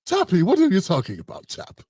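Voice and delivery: badly faked British accent; half-hearted British "old chap" impression; attempted UK accent. Host attempts his British friend, clumsy UK accent. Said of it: "got a good U K ac-, accent".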